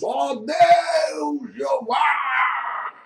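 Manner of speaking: hammy prophet calling to God; mock biblical prophet. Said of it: "Com essa voz de profeta".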